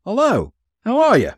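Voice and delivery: high, loud excited hello; exclaimed greeting. A narrator's reading. Cabman recognizes the man greeting him, exclaimed greeting. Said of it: "and then exclaimed".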